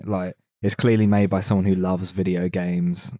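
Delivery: ordinary talk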